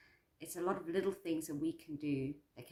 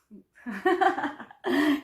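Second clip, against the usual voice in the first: bright burst of laughter; real laugh; 8 semitones higher, 10 dB louder than usual. Real, not acted. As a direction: laugh at your own softness. Laughing at herself over Bambi.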